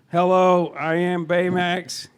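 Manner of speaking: slow, measured robot companion voice; Baymax. Acted. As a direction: attempt a cartoon care robot, drawing words out slowly. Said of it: "say my title with the Baymax accent".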